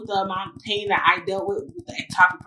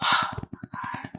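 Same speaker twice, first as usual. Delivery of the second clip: whispered inner thought; 7 dB quieter, slower than usual. Real, not acted. Thinking in her head.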